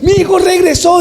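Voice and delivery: high, ringing cry of joy; joyful announcement. Acted. Father announces his son returned, high and joyful. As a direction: tell them all he's back.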